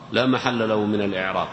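usual speaking voice

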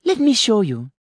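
put-on very high, eager child voice; eager little boy. A narrator's reading. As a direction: offer it like an excited small boy. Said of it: "the boy said".

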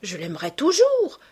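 high exclamation, rising on the last word; fervent, ringing declaration; passionate cry. A narrator's reading. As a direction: cry it out as a vow. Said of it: "s'écria-t-elle".

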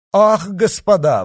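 high, loud exclamation; exclamation on seeing him. A narrator's reading. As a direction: cry out on seeing the others. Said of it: "воскликнул он, увидя коадьютора". (громкий возглас при встрече)

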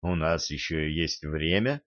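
low, soft, lightly unsteady reply; hushed, with a touch of a tremor; trembling voice. A narrator's reading. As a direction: drop low and soft, barely controlled. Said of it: "ответил Гарри дрожащим голосом".

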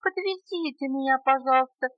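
high, tiny bug voice; piping, squeaky request; squeaking little beetle. A narrator's reading. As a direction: squeak it up high like a small insect. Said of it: "опять пропищал жучок".

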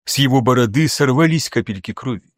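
plain reading voice

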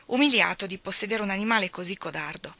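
ordinary delivery

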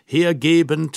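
usual speaking voice

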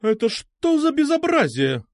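high, slow, booming diva voice; operatic indignation. A narrator's reading. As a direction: sing out your outrage like an opera singer. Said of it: "оперного контральта". (оперное возмущение)

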